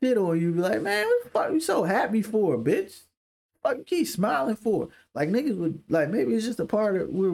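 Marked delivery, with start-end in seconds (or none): 0.8-4.9 higher-pitched, indignant challenge; mock confrontation. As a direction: act affronted by someone's happiness. Acting hostile reaction to a smile.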